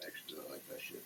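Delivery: a quick private whisper off to the side; whispered aside. Real, not acted. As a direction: drop to a breathy whisper, just for yourself. Whispered aside mid sermon.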